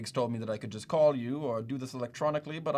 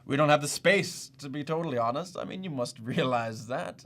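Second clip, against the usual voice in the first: ordinary talk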